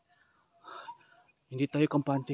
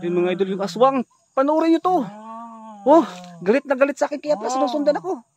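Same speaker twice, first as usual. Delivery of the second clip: loud and much higher, hyped warning; excited alarm; 10 semitones higher, 14 dB louder than usual. Pretend. Vlogger shows viewers the angry aswang, loud and high-pitched. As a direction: point it out to the viewers, all worked up.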